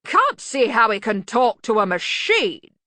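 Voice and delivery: loud, slow sullen grumble. A narrator's reading. Arch-Chancellor doubting the thinking machine, sullen. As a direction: grumble it out slowly. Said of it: "in a sullen voice".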